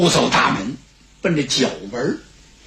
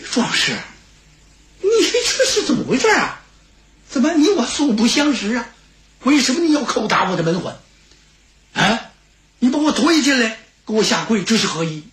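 put-on higher-pitched, startled old man; bewildered old steward; 5 semitones higher than usual. Old steward to a stranger kneeling before him, bewildered. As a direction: question the stranger in growing bewilderment.

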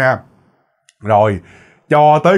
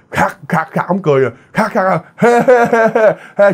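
mock cackle rising into high he-he-he; imitated cackle; 5 semitones higher than usual. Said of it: "ông cười, ông cười".

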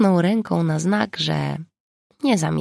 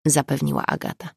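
ordinary delivery

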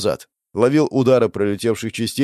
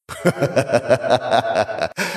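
long self-amused laughter; hearty laugh; 3 semitones higher than usual. Acted. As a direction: laugh it out fully. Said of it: "Вдоволь насмеялся я".

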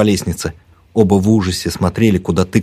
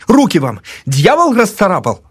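high, raised accusing voice; angry accusation; 10 semitones higher than usual. A narrator's reading. Narrator exposes her scratched arm, angry accusing. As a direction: hurl it at her in triumph.

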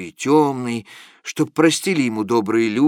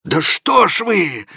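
higher, louder, slow outburst; a thundering reproach; Mark Ivanovich thunders; 6 semitones higher, 5 dB louder than usual. A narrator's reading. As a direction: boom it out in exasperation. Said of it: "прогремел".

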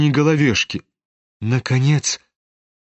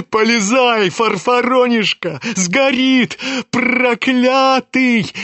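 much higher, slowing furious yell; roaring; 9 semitones higher, slower than usual. A narrator's reading. Ferdyshchenko yanking Ganya's sleeve, much higher furious yell. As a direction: scream the curse out long at the end. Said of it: "заревел Фердыщенко".

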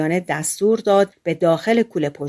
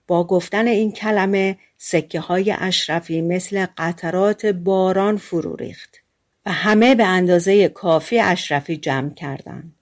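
ordinary delivery, nothing marked

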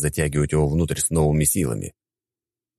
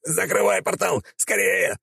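high, desperate shout; hammed-up panicked warrior calling out; urgent cry; slower than usual. A narrator's reading. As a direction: yell to hurry in a squeezed-up high voice. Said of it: "послышался голос из чёрного пролома".

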